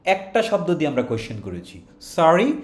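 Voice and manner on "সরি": "সরি" rising as a question; rising one-word question. Shown as a demonstration. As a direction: ask "সরি" with a lift. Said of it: "টোনটা rise করেছে".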